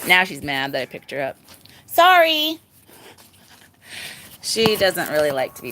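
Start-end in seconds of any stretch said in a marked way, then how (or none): 1.8-2.6 playful apology. Real, not acted. Apologising to annoyed pig.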